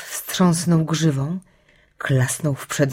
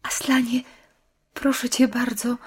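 put-on higher, hushed-edged girl's plea; pleading girl; 6 semitones higher than usual. A narrator's reading. Lucy pleads with Aslan. (błagalnie, wyższym głosem dziewczynki)

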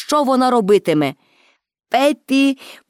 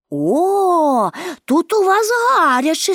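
drawn-out "oh", then high-pitched remark; lively exclamation; 6 semitones higher, slower than usual. A narrator's reading. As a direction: exclaim with light, high-voiced surprise. Pippi exclaims on entering window.

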